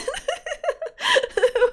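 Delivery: high loud burst of laughter at the broken slides; panicked laugh. Real, not acted. Streamer laughing at her failing slides, high and loud. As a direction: cackle in helpless panic.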